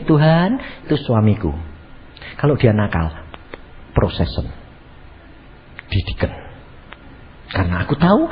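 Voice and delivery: low, matter-of-fact model prayer; right way of praying. Shown as a demonstration. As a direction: demonstrate a composed, frank prayer to God. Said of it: "kamu harusnya begini".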